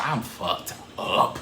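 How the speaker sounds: slow whisper. Retold or imitated. Drunk self-admission.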